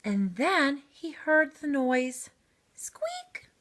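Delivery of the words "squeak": tiny, very high mouse squeak, soft. Acted.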